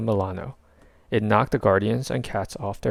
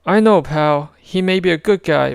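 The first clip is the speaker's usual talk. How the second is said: higher, louder raccoon; Rocket voice; 8 semitones higher, 8 dB louder than usual. A narrator's reading. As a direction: tough little critter reassures pal.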